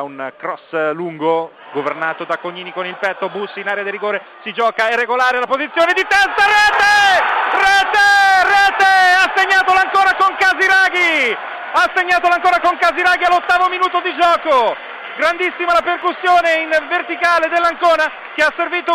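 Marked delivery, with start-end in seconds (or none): 4.4-6.4 screaming goal call, high and loud; goal commentary. Real, not acted. Goal scored, live radio call. 6.4-8.9 screamed high and loud; goal shout. Real, not acted. Goal scored, repeated shout. 9.2-11.4 P=A; goal shout. Real, not acted. Goal announced. 11.7-14.8 P=A; goal shout. Real, not acted. Goal announced again.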